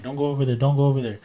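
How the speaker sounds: imitating a little girl pleading in fear; frightened plea. Daughter begging dad not to check the sink, scared and crying. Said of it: "And she starts crying".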